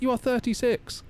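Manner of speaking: very high, emphatic mock wife; wife insisting. Wife firmly telling him he's thirty-six, voiced very high. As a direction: squeak it out as the wife. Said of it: "She's like".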